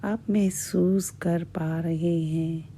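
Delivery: normal talking voice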